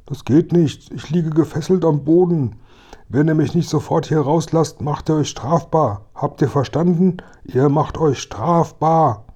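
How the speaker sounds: high, officious voice of a captive; indignant policeman, threatening. Acted. Tied-up officer warns the boys of punishment, indignant.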